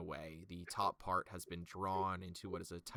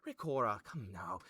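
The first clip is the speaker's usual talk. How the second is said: placating nobleman; 10 semitones higher, 9 dB louder than usual. Acted. Venthan hushes Sailor and Rakora, higher and smooth. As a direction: settle his bickering council. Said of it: "he watches Venthan and goes".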